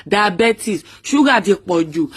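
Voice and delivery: diabetes said as "dabetis" in a Yoruba accent. Shown as a demonstration. Vlogger joking about the donuts, diabetes in a Yoruba accent. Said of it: "as in Yoruba accent".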